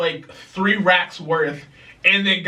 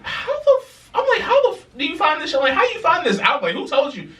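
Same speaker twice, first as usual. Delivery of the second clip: shocked disbelief; 12 semitones higher than usual. Retold or imitated. Retelling shocked reaction to story.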